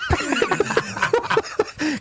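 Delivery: high, breathy burst of laughter; real laugh. Real, not acted. Man laughing at his awkward phone goodbye, real laugh. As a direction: crack up at yourself.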